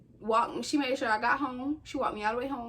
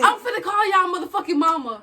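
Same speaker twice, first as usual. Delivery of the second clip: louder, higher mock threat from the dad; a hint of a furious dad snapping; mock angry dad; 6 semitones higher, 7 dB louder than usual. Imitating the angry dad threatening the kids, mildly loud. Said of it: "snapping so bad".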